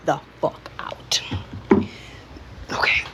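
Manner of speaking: soft whispered aside. Real, not acted. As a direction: say it without voice, under the breath. Dog asleep beside her.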